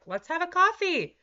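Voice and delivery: high, loud, eager sing-song; the brain begging for morning coffee, high and eager. Retold or imitated. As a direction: squeal for the coffee like a craving. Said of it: "Sometimes my brain's like".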